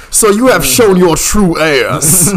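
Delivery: slow, loud, high theatrical outrage; hammed-up genteel accusation; mock scandalised drawl. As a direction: draw each word out in grand mock indignation. Mock scandalised reply to "gentleman.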